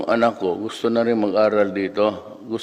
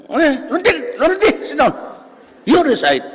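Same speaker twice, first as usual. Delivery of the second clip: high, louder shrill teacher's command; shrill professor; 3 dB louder, faster than usual. Retold or imitated. Professor ordering a student to recite, shrill high command. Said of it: "boses, ano, siya, shrill".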